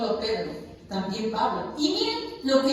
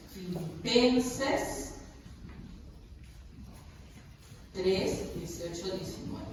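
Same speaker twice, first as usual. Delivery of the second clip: very slow, fading from soft to a whisper; repeating the verse softly; 6 semitones lower, 11 dB quieter, slower than usual. Real, not acted. Pastor murmuring the verse again while people find it, slow and fading. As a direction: let "Filipenses tres, dieciocho, diecinueve" sink away until "diecinueve" is barely voiced.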